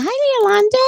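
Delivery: high, bright sing-song hi; excited high greeting. Real, not acted. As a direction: squeal the name with delight. Greeting viewers in live chat.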